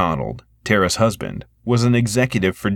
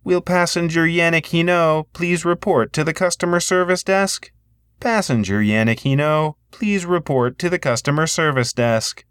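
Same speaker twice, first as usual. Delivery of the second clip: higher, PA announcer voice; airport announcement; 9 semitones higher than usual. A narrator's reading. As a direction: page a passenger over the PA. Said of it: "spoke into the microphone".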